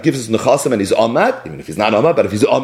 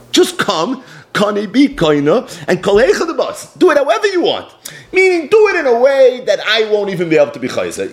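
pleading wife; 7 semitones higher than usual. Retold or imitated. Wife pleads with husband to come.

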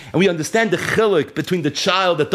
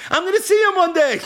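David promising himself the reunion, pitched up high; rising fervor; 12 semitones higher than usual. Acted. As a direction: lift it up with hope the second time. David consoling himself, said twice.

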